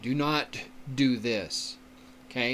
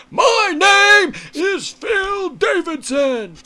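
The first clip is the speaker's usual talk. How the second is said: much louder, high-pitched slow bellow; mock shout; 12 dB louder, slower than usual. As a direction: imitate a speaker shouting as if ready to fight. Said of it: "like he was shouting".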